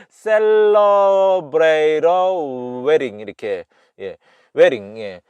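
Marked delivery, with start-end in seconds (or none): 0.1-3.2 very slow, high demo with R-like T and D; imitated flapped pronunciation. Shown as a demonstration. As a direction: CELEBRATE A WEDDING stretched out high as "sel-lo-brei-ro wering". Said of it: "이렇게 발음하는 것 같아".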